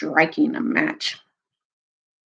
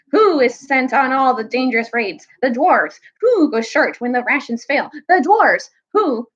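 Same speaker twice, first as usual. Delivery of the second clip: higher, indignant rhetorical questions; aggrieved; 5 semitones higher than usual. Acted. Dwarf complains of his people's hardships, aggrieved. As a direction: build the grievance with each answer. Dwarf's mounting angry grievance.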